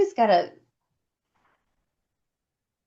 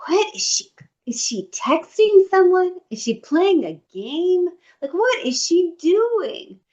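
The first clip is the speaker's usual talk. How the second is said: higher, incredulous questions about her neighbour; exasperated wonder; 6 semitones higher than usual. Retold or imitated. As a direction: play the baffled inner voice. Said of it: "I'm like".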